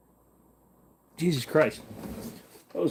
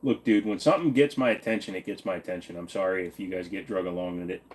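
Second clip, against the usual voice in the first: usual speaking voice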